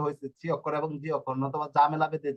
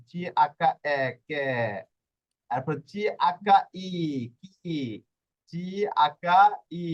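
slow spelled-out demo; slower than usual. Shown as a demonstration. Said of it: "এটা কে করার জন্য আকা দেওয়া হয়েছে".

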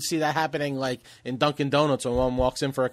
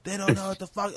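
quoted rant; 6 semitones higher than usual. Retold or imitated. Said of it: "saying, you know".

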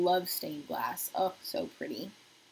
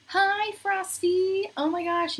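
excited greeting; 11 semitones higher, 6 dB louder, slower than usual. Real, not acted. Greeting new viewer joining live.

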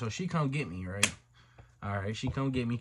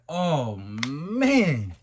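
loud dismay; 8 dB louder than usual. Retold or imitated. Acted dismay at the revelation.

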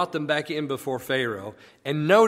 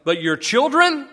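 put-on raised, louder, jumping high on "children"; backhanded blessing; 5 semitones higher, 6 dB louder than usual. Pharaoh blessing Moses with a catch, pointedly backhanded. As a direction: bless them, then turn it into a warning. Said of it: "it's sort of a backhanded blessing".

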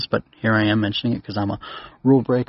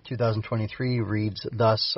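ordinary talk, nothing marked

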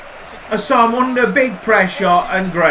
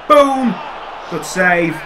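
normal talking voice

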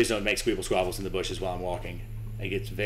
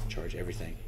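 softer, under-breath order to himself; plain; 5 semitones lower, 11 dB quieter than usual. Real, not acted. Streamer quietly telling himself to charge everything. Panicking as the chaser nears.

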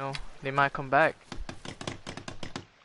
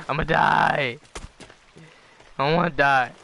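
panicked; 7 dB louder than usual. Real, not acted. Panicking as he is attacked.

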